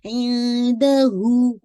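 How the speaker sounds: slow chanted इंदहू; light ikhfa, long हू; recitation demo. Shown as a demonstration. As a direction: melodic tajweed. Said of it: "इख्फा कीजिएगा light".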